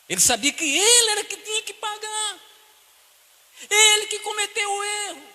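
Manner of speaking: loud, high preaching cry; preaching shout. Real, not acted. Preacher crying out to the church, loud and high. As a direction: shout the point out to the congregation.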